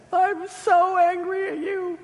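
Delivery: high, tearful cry of anger; a dying mother sobbing out her anger; tearful outburst. Retold or imitated. Mother weeping at her estranged son, high and tearful. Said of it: "she burst into tears and said".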